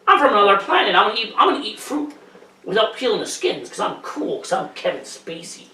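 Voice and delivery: sneering send-up of a smug actor; mock Spacey, high then trailing off; Kevin Spacey impression. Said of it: "is that your impression".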